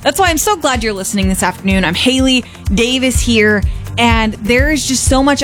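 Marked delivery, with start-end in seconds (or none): none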